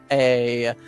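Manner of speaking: ordinary talk, nothing marked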